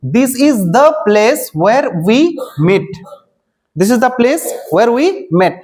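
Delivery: very slow, word by word, for students to copy; slow dictation. Shown as a demonstration. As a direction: spell it out slowly for the class. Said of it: "जैसे कि देखिए". (छात्रों के लिए बहुत धीरे, शब्द-शब्द बोलना)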